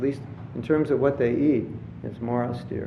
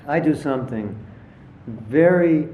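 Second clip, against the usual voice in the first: ordinary talk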